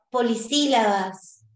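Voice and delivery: normal talking voice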